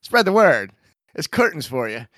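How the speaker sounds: high, mock gangster voice; forties mobster. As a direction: ham it up like a 1940s mobster. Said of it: "forties mobster movie".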